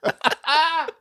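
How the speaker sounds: loud, high words through laughter; laughing hard. Real, not acted. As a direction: crack up while teasing the co-host. Cracking up at co-host's answer.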